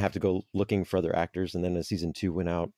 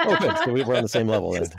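laughing; 4 semitones higher, 6 dB louder than usual. Real, not acted. Laughing reply to joke.

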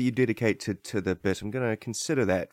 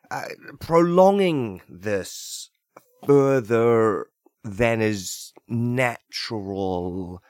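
slow stalling; 2 semitones lower, slower than usual. Acted. Stalling, slowing down.

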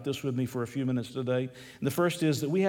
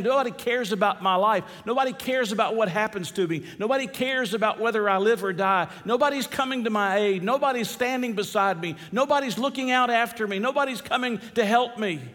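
high, insistent lament; impassioned plea, building higher with each complaint; David crying out; 6 semitones higher than usual. Acted. Preacher voices David with no one to help, crying out. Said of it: "David cries out these words".

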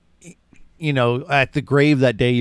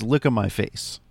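gentle mother; 4 semitones lower, 5 dB quieter than usual. Retold or imitated. Host voicing the mother calming her son, low and tender. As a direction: lower and softer, tender reassurance. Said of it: "And she does".

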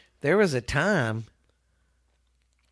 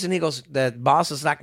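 usual speaking voice

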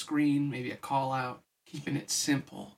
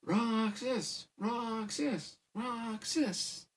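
high, soft mock crowd chant; hushed, higher-pitched repeated cheer; 8 semitones higher, 4 dB quieter, much slower than usual. A narrator's reading. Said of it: "The crowd clamped and cheered".

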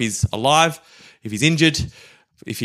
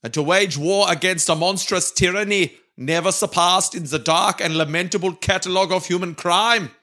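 orate grandly in a mock German accent; 5 semitones higher than usual. Reading Churchill's war speech on request, in a German accent. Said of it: "with a German accent, as requested".

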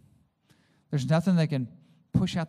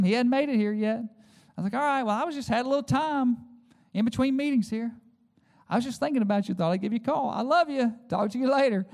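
higher, syrupy loving voice; husband sweetly pretends all is fine; sugary fake-sweet; 8 semitones higher than usual. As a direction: cover the anger with sweetness. Fake-sweet call to wife.

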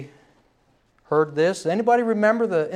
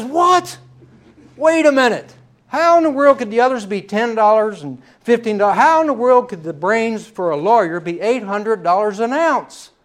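high, loud incredulous protest; a client balking at an outrageous price; shocked outrage; 5 semitones higher, 5 dB louder than usual. Acted. The joke's patient protests the doctor's price, shocked and loud.

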